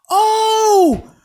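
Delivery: high startled yelp of realization; sudden shout. Real, not acted. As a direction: yell it as the idea hits.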